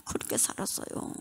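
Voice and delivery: a soft, breathless whisper repeating "그렇게 살았어요"; a resigned, whispered "그렇게 살았어요"; whispered echo. Real, not acted.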